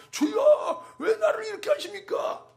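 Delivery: mock trembling plea. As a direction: play a scared man whimpering to God. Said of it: "겁먹고 벌벌 떨면서".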